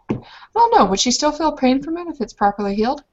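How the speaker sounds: ordinary delivery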